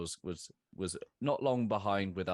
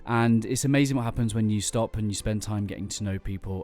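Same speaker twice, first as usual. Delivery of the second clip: polished podcast intro read over music; announcer read; 10 dB louder than usual. Acted. Host introducing his podcast episode, announcer read. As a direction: sell the show like a radio host.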